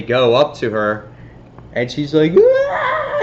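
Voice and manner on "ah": short high shriek of fright; startled scream. Retold or imitated. CEO cornered by Spider-Bots, shrieking in fright. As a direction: yelp like she's just been caught. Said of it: "she starts freaking out".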